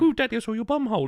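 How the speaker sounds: high, fast mock child voice, lightly put on; childish son voice. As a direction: squeak it out fast like a little boy. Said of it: "why are you speaking like that?".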